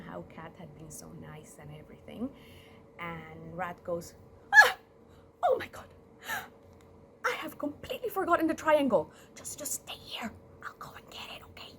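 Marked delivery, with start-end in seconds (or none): 4.5-9.1 high, loud gasp of sudden remembering; hammed-up panic at forgetting something; feigned alarm. Rat excusing himself from the funeral, mock panic. Rat fakes having forgotten the triangle. 9.3-11.7 quick whispered reassurance; hushed aside. Acted. Rat slipping out of church, whispering.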